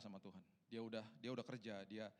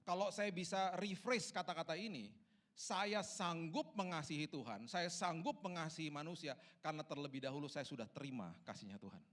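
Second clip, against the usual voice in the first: loud, high, slow declaration; emphatic, drawn-out preacher delivery; impassioned preaching; 6 semitones higher, 6 dB louder than usual. Real, not acted. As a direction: proclaim it weightily to the congregation.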